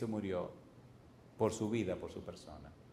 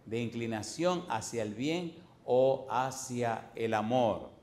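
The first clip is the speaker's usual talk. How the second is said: slow dictation; slower than usual. Shown as a demonstration. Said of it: "¿Falta de qué?".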